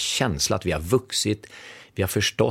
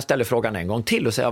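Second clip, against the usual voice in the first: ordinary delivery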